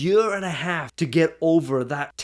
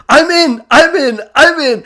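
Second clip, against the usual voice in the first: high, loud eager volunteering; excited chorus; 10 semitones higher, 10 dB louder than usual. Retold or imitated. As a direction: imitate everyone piling in excitedly. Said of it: "it was like this whirlwind of excitement".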